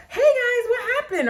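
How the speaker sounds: very high, loud sing-out; mock cheery greeting. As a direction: pretend to pop in with a silly, chirpy hello. Mock casual greeting she would not give.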